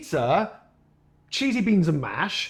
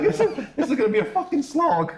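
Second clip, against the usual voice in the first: high-pitched, laughing through the words; 9 semitones higher than usual. Real, not acted. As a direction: laugh at your own misery as you say it. Laughing at his own state.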